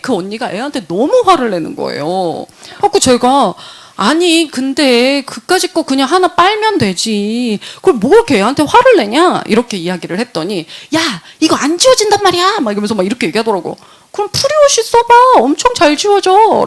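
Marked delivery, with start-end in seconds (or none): 3.9-9.4 indignant, higher and louder; mock scolding, pitched up; retold protest. Scolding friend angry at child. 10.9-12.6 high, loud mock snapping; acted-out irritated outburst; retold angry retort. Acted angry retort about a stain. 14.1-16.6 very high, loud insistent urging; acted-out keen recommendation; retold eager pitch. Acted eager retort recommending product.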